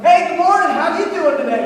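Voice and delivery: loud, high mock-chipper hello; hammed-up cheerful office greeting; happy, fired-up greeting. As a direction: imitate an overly keen morning colleague. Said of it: "happy and fired up".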